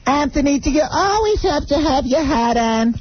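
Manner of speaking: put-on high old-lady nag; mother-in-law voice. Mother-in-law nagging Anthony about his hat, in a high nag. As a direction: needle him about the hat in a high whine.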